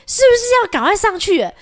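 high, alarmed quoted worry; startled question. Retold or imitated. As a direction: ask it, suddenly panicked. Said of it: "我们两个想说". (突然慌张的自问)

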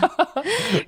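short rising laugh; a quick bright giggle. Real, not acted. Laughing after self-deprecating joke. (轻快的笑声)

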